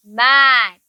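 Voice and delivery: मैट drawn out with a long vowel and falling tone; pronunciation demo. Shown as a demonstration. Teacher shows the class how to say मैट, pronunciation demo. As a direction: stretch the vowel of मैट, clearly. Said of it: "इसको हम कैसे pronounce करेंगे?".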